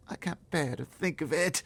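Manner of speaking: put-on high girl's voice, slow and pained; Sophie crying out. Girl telling the giant she can't bear it, high and slow. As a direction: cry out that you can't bear it. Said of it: "Sophie cried".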